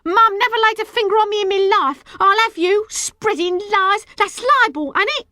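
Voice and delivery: high, loud tirade; furious, high-pitched protest; outraged shouting. A narrator's reading. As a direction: rant it shrilly at your accuser. Girl keeps up shrieking row.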